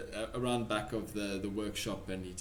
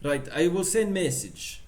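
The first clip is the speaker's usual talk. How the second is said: put-on loud, higher Russian accent; 6 semitones higher, 7 dB louder than usual. Pavlov promising to send message, loud Russian accent. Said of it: "I keep losing the accent".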